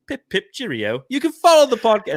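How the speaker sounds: hammed-up British accent, pitch jumping high; horrible British accent. Podcast host warns British listeners he's going British, horrible British accent. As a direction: put on a bad, plummy British accent. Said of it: "This is gonna be a horrible British accent".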